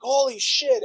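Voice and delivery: high squeaky holy shit; shocked. Retold or imitated. Group shocked by the porn.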